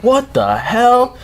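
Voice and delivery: high, drawn-out mock outburst; exasperated disbelief. Gamer watching the power go off again, exasperated cry. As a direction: stretch it slowly up high in disbelief.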